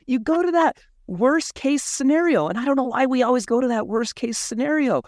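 high-pitched, laughing exasperation; amused exasperation. Real, not acted. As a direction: laugh at your own overreaction, pitched high. Laughing at his own overreaction.

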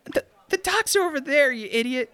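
high exasperated snap; annoyed high whiny man. Acted. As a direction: whine it out, fed up. Thug snaps at hero.

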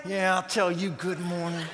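a touch of bitter good morning; sarcastic grumble. Retold or imitated. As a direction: mutter it back with grudging sarcasm. Frazzled parent's grumbling reply.

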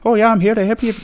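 high, lilting mock Irish; Irish accent. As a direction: attempt an Irish accent while half laughing. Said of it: "an Irish accent and a Mancunian".

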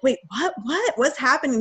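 high-pitched, bewildered questioning; confused. Retold or imitated. Roommates reacting to news of a death, confused. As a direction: imitate baffled friends, pitch jumping up. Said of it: "They were so confused".

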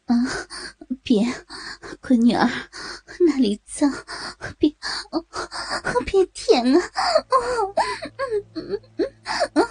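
halting words between gasps; acted moaning. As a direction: protest weakly, then give way to moans. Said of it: "忍不住大声吟叫了起来".

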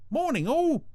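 very high, loud sing-out greeting; chirpy little character voice; cheery Brian. A narrator's reading. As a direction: call it out in a high-pitched chirp.

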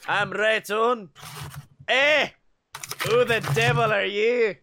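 hammed-up Scottish engineer; clipped Scottish accent. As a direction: play a Scottish engineer confronting strangers. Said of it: "in his clipped Scottish accent".

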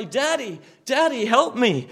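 loud, rising plea for help; crying for help. Pretend. Believer drawing near to God, loud cry for help. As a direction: call out to Daddy for help. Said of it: "and you cry".

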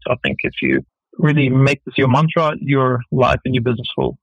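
ordinary talk

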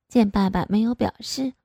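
ordinary delivery

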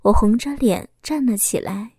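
ordinary delivery

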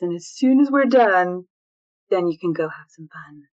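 gently coaching kids as a cartoon hedgehog; Shadow voice. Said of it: "I would do a voice as Shadow".

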